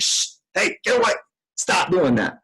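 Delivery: imitating a sharp shush at an interruption; snappish hushing. Father shooing kids away during a call, snappish hushing. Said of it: "that feels like I'm yelling at them".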